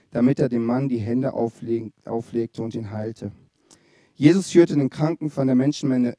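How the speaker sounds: low, measured Bible reading; scripture reading. Acted. Preacher reading the Markus passage to the congregation, measured and low. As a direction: read the verses slowly and solemnly.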